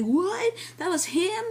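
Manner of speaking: high-pitched incredulous question; astonished disbelief. Retold or imitated. Reviewer recalling the credits revealing the actor, astonished.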